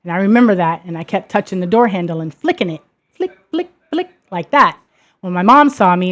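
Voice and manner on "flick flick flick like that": flick sounds. Retold or imitated. Imitating door handle flicking.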